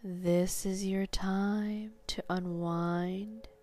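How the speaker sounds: sleep story narrator. Acted. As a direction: guide them down into sleep, slowly. Guided hypnosis induction.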